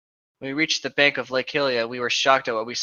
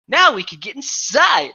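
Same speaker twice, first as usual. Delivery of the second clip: mistaken Link voice; 9 semitones higher, 5 dB louder than usual. Acted. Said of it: "that was not Link voice".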